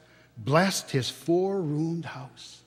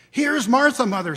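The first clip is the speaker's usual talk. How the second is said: put-on higher, louder girl's call announcing herself; 8 semitones higher, 8 dB louder than usual. A narrator's reading. Daughter arriving home calls to her mother, high and loud. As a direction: pop in bright and loud as the daughter. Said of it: "said a girl".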